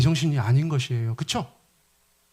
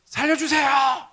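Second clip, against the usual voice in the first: much higher, slow frantic cry; a drowning man's high, slow plea for help; desperate plea; 10 semitones higher, 3 dB louder, slower than usual. Acted. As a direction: beg for your life. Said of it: "우리가 쓰는 말로 하면".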